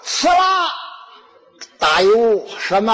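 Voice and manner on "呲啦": high-pitched "ci-la" sound effect; imitated sizzle. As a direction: mimic food hitting hot oil. Repeats the riddle's noise back.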